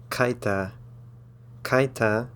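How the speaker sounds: kaita in two syllables, KAI-ta; diphthong. Shown as a demonstration. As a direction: say the ai of kaita as one sound, like English eye. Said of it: "come together to make an I".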